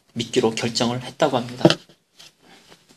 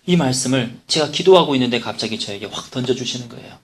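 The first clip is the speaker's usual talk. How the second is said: ordinary talk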